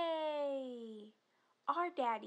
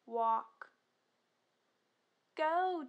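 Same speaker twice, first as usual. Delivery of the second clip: ordinary talk, nothing marked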